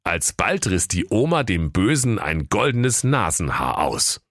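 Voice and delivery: plain reading voice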